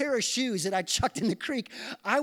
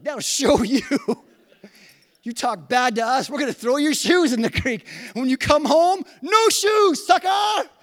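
put-on loud, higher jeering shout at the kid; mocking taunt; 7 semitones higher, 11 dB louder than usual. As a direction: yell it like a cocky teenager taunting. Kids taunting bully at creek.

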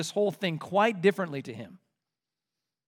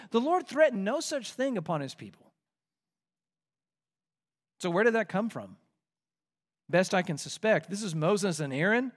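usual speaking voice

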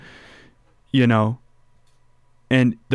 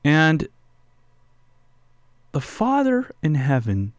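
usual speaking voice

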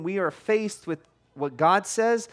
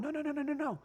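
put-on higher, softer no no no to a child; gentle higher calling; 7 semitones higher, 9 dB quieter, faster than usual. Church calling a wandering member to turn around, higher and softer. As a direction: coax the kid back, high and soft. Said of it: "we're going".

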